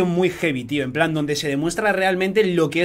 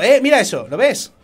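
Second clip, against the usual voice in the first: high loud look at this; excited showing off; 7 semitones higher, 6 dB louder than usual. Real, not acted. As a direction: pitch up, loud, proud show. Showing off his sword.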